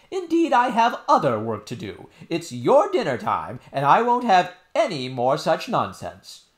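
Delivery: high, loud, scolding nurse voice; cross nurse. Acted. Nurse ordering princess to dinner, cross and loud. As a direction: shut down the nonsense sharply.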